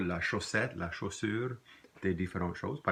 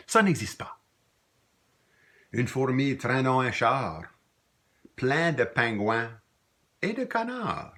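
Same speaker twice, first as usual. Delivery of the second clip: high, loud, measured verse; poem recitation; 4 semitones higher, 4 dB louder than usual. Acted. Teacher reciting the ant poem to students, emphatic poem reading. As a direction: declaim each line with pauses between.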